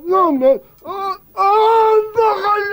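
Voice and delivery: high pained cry as he falls. Acted. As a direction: wail as you hit the floor. Falls from the tree.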